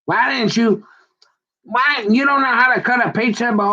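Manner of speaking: high-pitched mock scolding from a wife. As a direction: imitate a wife picking at her husband. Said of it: "she'll come and be like".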